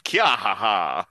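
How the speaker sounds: slow, stylised villain-style cackle; signature laugh. Acted. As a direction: draw out a put-on character laugh. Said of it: "gonna be her signature laugh".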